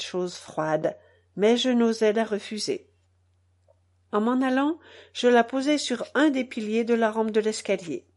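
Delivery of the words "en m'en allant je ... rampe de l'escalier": ordinary delivery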